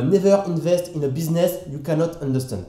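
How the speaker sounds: English quote in a strong French accent. Shown as a demonstration. Quoting Warren Buffett to his viewers in French-accented English. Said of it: "enjoy mon accent français".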